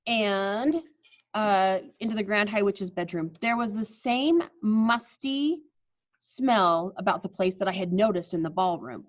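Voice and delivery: normal talking voice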